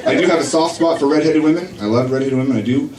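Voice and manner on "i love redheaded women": ordinary talk, nothing marked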